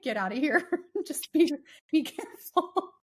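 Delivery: chuckling through the words, rising high; laughing officer. Retold or imitated. Officer chuckling as he lets her go, laughing. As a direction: let a laugh break in as you wave her off. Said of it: "He just chuckled and he was like".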